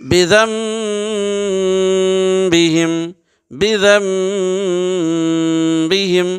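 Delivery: Quranic recitation. Shown as a demonstration. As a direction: intone each syllable like a qari. Teacher recites Quran example. (تجوید کے ساتھ آہستہ تلاوت)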